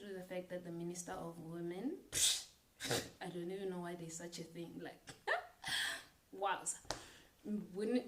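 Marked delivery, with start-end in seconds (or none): 2.0-2.5 a quick scornful laugh; a short laugh at the absurdity. Real, not acted. Laughs at the ministry. 5.1-6.0 high unvoiced laugh; a high airy laugh of disbelief; breathy disbelieving laugh. Real, not acted.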